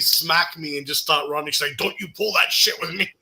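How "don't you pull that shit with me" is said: higher, amused warning; mock scolding. Best friend warning him off after the prank, mock scolding. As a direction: imitate her telling him off.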